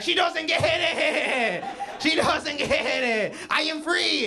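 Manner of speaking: very high, loud wail of liberation; hammed-up jubilant shriek; ecstatic cook crying out. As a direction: cry out as if set free.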